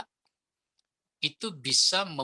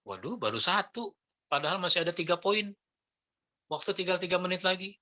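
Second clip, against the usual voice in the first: flustered speaker; 6 semitones higher than usual. Acted. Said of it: "jangan ngomong seperti itu".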